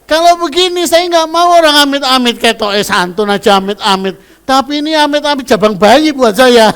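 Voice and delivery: high, loud, laughing plea; laughing prayer. Retold or imitated. Mother retelling her prayer against the suitor, loud and laughing. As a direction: beg God off with a laugh. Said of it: "tapi saya bilang".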